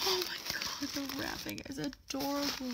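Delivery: high delighted squeal. Real, not acted. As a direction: gush over it. Admiring cute wrapping.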